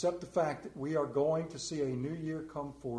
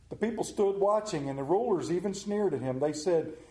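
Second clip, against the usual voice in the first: loud, high, preaching scripture at full force; scripture reading; 6 semitones higher, 6 dB louder than usual. Acted. Preacher reading Luke to the church, loud and fervent. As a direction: proclaim the Gospel passage to the congregation.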